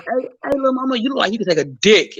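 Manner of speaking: high-pitched imitation of a crude catcall; mock catcaller. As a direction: call out like a street pest.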